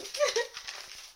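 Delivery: short breathy laugh; a quick airy giggle at herself. Real, not acted. Laughs at her own mix-up.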